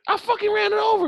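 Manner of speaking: high, loud triumphant cry; gleeful boast. Real, not acted. Bragging to Matt about running over the sign, high and loud. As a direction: crow about it proudly. Proud retelling, laughing friend.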